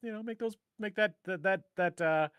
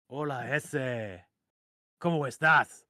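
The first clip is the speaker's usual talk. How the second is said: mock Chicano accent; 2 semitones lower, slower than usual. Host voicing Chicano greeting on accents.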